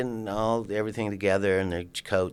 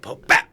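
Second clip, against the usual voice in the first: loud popping paint splat noise; loud mock pop bap; imitated splat; 9 dB louder than usual. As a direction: burst out the splat sound.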